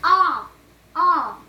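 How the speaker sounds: scream. Acted. Daddy Plum spots the mouse, shrieks in fright.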